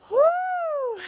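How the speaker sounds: high "woo" on an exhale; effort woo. Real, not acted. Instructor puffing through her workout, high effort woo. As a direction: blow out a high, airy woo.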